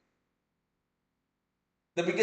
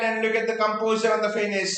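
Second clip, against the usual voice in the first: ordinary talk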